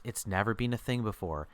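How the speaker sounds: ordinary talk, nothing marked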